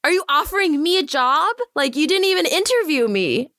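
high, stunned what; can't believe this offer is real; retelling being offered a job out of nowhere, in disbelief. Retold or imitated. Retelling her shock.

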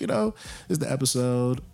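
ordinary delivery

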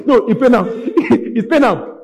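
high, loud jeering through laughter; mock their pain, laughing hard; laughing taunt. Preacher as enemy enjoying your pain, loud laughing taunt.

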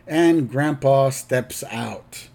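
ordinary talk

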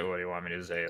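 a dismissive coach who is over it; grumpy monotone. Retold or imitated. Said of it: "press conferences, he's just like".

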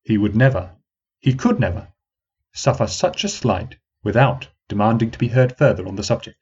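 usual speaking voice

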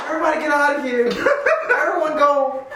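high, urgent mock teacher command; retold teacher's order. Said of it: "She said".

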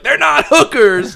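high, drawn-out protest yell; indignant mock shout. Roommate defends the girls, indignant mock shout. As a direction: holler it back in pretend outrage.

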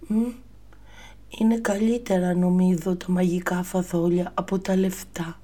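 put-on a pleased little boy deciding; Jack as a lisping boy. Jack deciding beans beat money, high lisping child voice.

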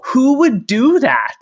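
high, slow, disbelieving question; drawn-out outraged disbelief; incredulous. Retold or imitated. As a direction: sound stunned that anyone would leave you. Player reacting to being abandoned.

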